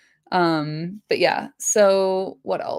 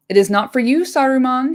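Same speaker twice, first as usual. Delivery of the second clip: a touch of a shrill, toneless cry, louder; mild shrill cry at Saruman; 6 semitones higher, 5 dB louder than usual. A narrator's reading. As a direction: cry it out thin and flat. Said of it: "he cried in a shrill and toneless voice".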